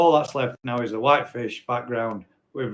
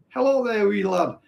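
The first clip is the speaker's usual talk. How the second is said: a mock Scottish greeting, pitched higher; Scottish accent; 6 semitones higher than usual. Retrying the viewer's greeting in a put-on Scottish accent. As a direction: have a second go at the Scottish accent. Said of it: "Did that sound Scottish?".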